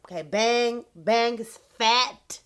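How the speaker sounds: usual speaking voice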